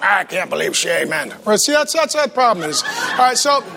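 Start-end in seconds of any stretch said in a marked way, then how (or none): 0.0-1.3 low grumble, put-on voice; mock disbelieving congregant. Congregant objecting to the amen, mock disbelief. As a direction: play a disapproving churchgoer. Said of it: "Some of you are like".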